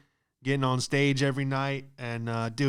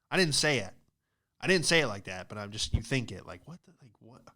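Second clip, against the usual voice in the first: ordinary talk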